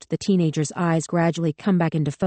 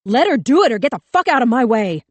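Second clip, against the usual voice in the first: higher, much louder, frantic yelling; panicked scream; 6 semitones higher, 6 dB louder than usual. A narrator's reading. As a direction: scream it with the gun raised. Cop screaming on.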